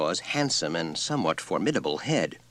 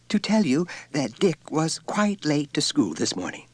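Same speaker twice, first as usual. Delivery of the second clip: teacher voice; 9 semitones higher than usual. A narrator's reading. Teacher reports the boy's lateness.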